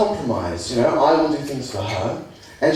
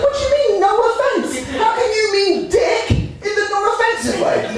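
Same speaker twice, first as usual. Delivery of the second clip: high, outraged retort; mock-offended reply to the flatmate, pitched up; indignant disbelief. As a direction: demand an answer in shrill disbelief.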